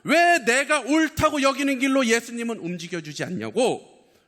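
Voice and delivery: high, loud preaching cry; impassioned preaching. Real, not acted. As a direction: preach it at full pitch, pressing every phrase. Preacher at full force.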